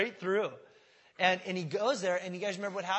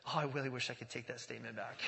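regretful; 5 semitones lower, 5 dB quieter than usual. Retold or imitated. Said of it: "replays this conversation in her head".

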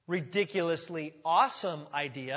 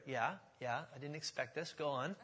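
softer, reluctant sidekick voice; hesitant mumble; 5 semitones lower, 11 dB quieter than usual. Acted. Armor bearer hesitantly humoring Jonathan, softer mumble. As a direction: murmur it like you'd rather not.

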